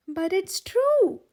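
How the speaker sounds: high, loud and slow, insisting; protesting. A narrator's reading. Belle protesting to the angry crowd, loud and slow. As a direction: plead it is true to the crowd. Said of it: "Belle protested".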